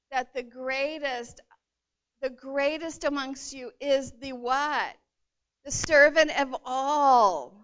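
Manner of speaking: slow build to a loud climax on servant of all; building emphasis. Real, not acted. Preacher to congregation on true greatness, slow and rising to a loud climax. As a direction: drag it out, then land servant of all loudly. Preacher drives home the point.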